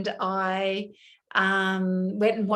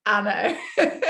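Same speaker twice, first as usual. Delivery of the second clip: laughs high before and after the words; amused laugh through a knowing reply; laughing at a nightclub invite; 4 semitones higher than usual. Real, not acted. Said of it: "it was just funny".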